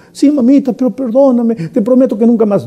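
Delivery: a son pleading with his mother not to be punished, high and begging; child pleading. Acted. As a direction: beg mamita like a frightened child.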